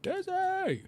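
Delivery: high call across the house. Real, not acted. Calling out to someone in game.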